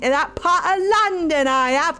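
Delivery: hammed-up Cockney banter; Cockney accent. Streamer proving to chat they can do Cockney accent. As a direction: show off a jaunty Cockney accent. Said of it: "Cockney I can do".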